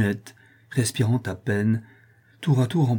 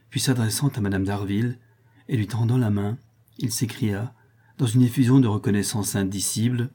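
usual speaking voice

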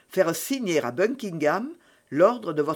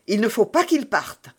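a higher, mildly urgent cry; alarmed exclamation; 5 semitones higher, 3 dB louder than usual. A narrator's reading. Milady learning Buckingham leaves tomorrow, mildly alarmed cry. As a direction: blurt it out, forgetting composure a little. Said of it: "s'écria Milady, oubliant sa présence d'esprit".

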